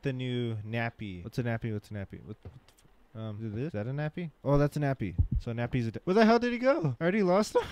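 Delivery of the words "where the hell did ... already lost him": high, loud startled outburst; sudden high-pitched panic; alarmed shout. Real, not acted. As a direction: shout in disbelief at losing him. Baby vanished, player alarmed.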